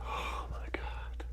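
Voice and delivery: slow, hushed oh my God; breathless whisper. Real, not acted. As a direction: whisper it in disbelief. Patient overwhelmed after second adjustment.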